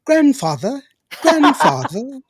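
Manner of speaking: high, loud, prim mock grandchild; exaggerated proper-speaking child, no Texas twang; very proper grandchildren. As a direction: imitate overly proper, British-sounding kids. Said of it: "that's how my grandchildren speak".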